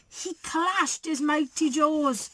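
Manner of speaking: ordinary delivery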